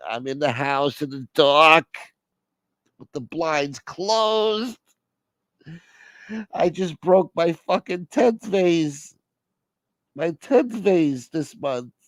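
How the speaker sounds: high, slow, drawn-out lament; hammed-up parent wallowing in misery; miserable melodramatic caller. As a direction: moan each phrase out theatrically. Plays miserable caller on phone.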